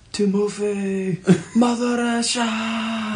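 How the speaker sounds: slow, high, drawn-out mock Russian accent. Podcaster mocking the Russian center in a slow, high mock Russian accent. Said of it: "the Russian accent".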